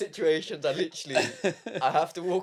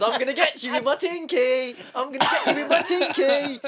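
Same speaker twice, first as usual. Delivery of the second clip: put-on cheeky toddler; 9 semitones higher, 4 dB louder than usual. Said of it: "He goes".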